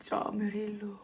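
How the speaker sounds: whispered Murillo under her breath. Real, not acted. Listing a tricky Spanish name, whispered.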